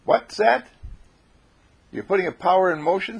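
high-pitched incredulous question; acted disbelief; puzzled leader. A narrator's reading. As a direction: repeat it back in surprise. Leader questions John, astonished.

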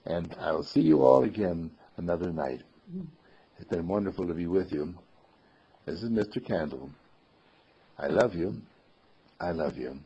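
soft, low loving farewell; tender bedtime sign-off. Real, not acted. Mister Candle bids the children another night, tender and soft. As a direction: say goodnight gently, letting the voice sink.

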